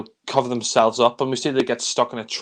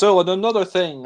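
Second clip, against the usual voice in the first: higher, a touch louder, pompous afterthought; tossed off like a self-important inspector; lightly done Clouseau quip; imitating Clouseau; 8 semitones higher, 4 dB louder than usual. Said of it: "Clouseau says".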